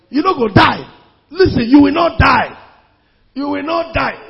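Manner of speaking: loud preaching shout, you will not die; forceful declaration. Acted. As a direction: declare it with full force over them. Preacher declaring over the congregation.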